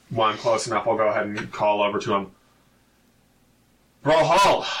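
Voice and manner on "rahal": loud hail across the fort; calling out. Acted. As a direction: call out to get his attention. Calling over to a troll.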